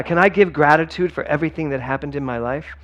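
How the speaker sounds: usual speaking voice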